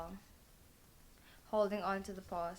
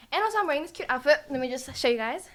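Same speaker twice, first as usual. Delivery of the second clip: high, loud and fast excited chatter; thrilled fast showing-off voice; 5 semitones higher, 10 dB louder, faster than usual. Real, not acted. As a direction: gush about the cute outfit at full speed. Excited to show her outfit.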